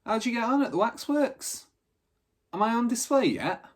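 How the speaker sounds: lightly voiced cheeky exchange; cheeky banter. Benny and Dippy banter.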